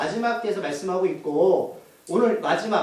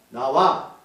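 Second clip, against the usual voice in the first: low, firm order; command; 7 semitones lower, 3 dB louder, much slower than usual. Retold or imitated. Preacher voices Jesus ordering the demon out, low firm command. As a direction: order it out in a lowered voice. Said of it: "나도 명령해요".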